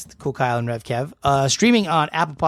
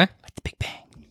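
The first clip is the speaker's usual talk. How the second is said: whisper; 8 dB quieter, slower than usual. Pretend. Whispered joke aside.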